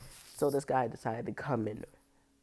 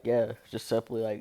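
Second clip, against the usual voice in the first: usual speaking voice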